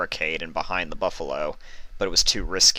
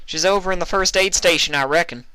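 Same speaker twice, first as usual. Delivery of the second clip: much higher, louder country mare; Applejack voice; 11 semitones higher, 6 dB louder than usual. A narrator's reading. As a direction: call it out over the gunfire.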